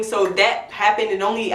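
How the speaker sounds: ordinary talk, nothing marked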